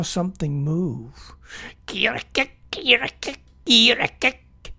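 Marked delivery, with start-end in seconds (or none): 1.9-4.7 high croaking kirikik, building louder; rising alarm croak, each louder than the last; frog croak. A narrator's reading. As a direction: croak it out like a tree frog warning. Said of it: "he croaked".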